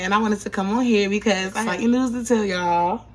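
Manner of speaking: ordinary talk, nothing marked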